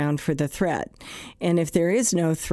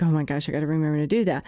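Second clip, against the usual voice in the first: quick, a bit lower fretful mutter to oneself; rushed worried self-talk; 3 semitones lower, much faster than usual. Retold or imitated. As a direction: hurry through it like a nagging thought. Anxious thought voiced.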